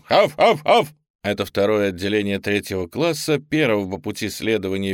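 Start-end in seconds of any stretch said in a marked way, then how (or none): 0.1-0.9 loud, high barked chant; barked greeting. Acted. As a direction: bark it out like a drilled squad. Cadets bark greeting.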